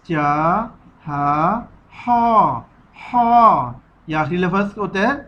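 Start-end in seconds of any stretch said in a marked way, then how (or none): 1.9-3.8 "हा" pushed out with pressure from the upper throat; pressed throat हा. Shown as a demonstration. Teacher shows children the throaty letter, "हा" pressed from the throat. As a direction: squeeze "हा" out from high in the throat. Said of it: "दबाकर ऊपर वाले हलक से निकलता है".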